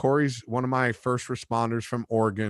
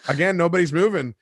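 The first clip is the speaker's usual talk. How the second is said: ordinary delivery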